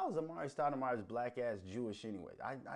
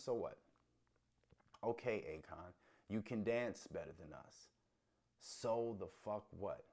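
ordinary talk

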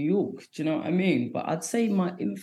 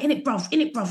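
higher, quick London "in it, bruv"; nervous London slang; 8 semitones higher, 4 dB louder, faster than usual. Retold or imitated. Comic mimics her nervous London slang on stage. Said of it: "I'll be like".

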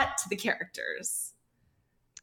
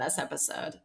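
ordinary delivery

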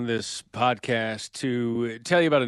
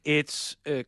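upbeat sponsor read, deliberately emphatic; 4 semitones higher than usual. Acted. Host pitching the sponsor's new drink, announcer style. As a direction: sell it like a radio announcer.

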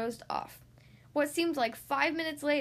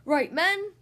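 loud, high sergeant-style call; commanding; 6 semitones higher, 8 dB louder than usual. A narrator's reading. Dad organizes his children as troops, commanding. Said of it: "he commanded".

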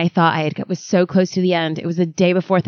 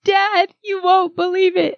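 higher, slow despairing plea; distressed daughter; 11 semitones higher, slower than usual. Retold or imitated. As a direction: break the bad news in despair. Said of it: "I was like".

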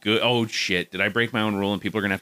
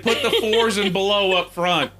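sleazy vice principal; 8 semitones higher, 3 dB louder than usual. Retold or imitated. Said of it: "He's like".

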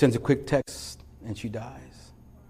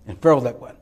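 loud, high, driving sermon voice; preaching at full force; 4 semitones higher, 8 dB louder than usual. Acted. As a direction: preach it out, building to a cry. Pastor preaching to congregation.

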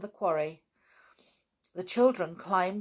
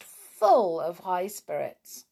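ordinary delivery, nothing marked

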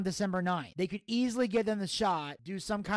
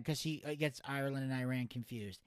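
ordinary talk

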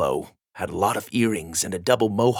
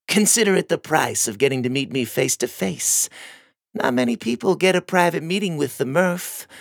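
sinister; 7 semitones higher than usual. A narrator's reading. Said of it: "Murph smiled a sinister smile".

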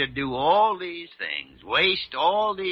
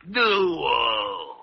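a sharp cry of shock and sympathy; 3 dB louder than usual. Acted. Older doctor hearing of the tube test, cries out in horror. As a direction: recoil from the idea with a cry.

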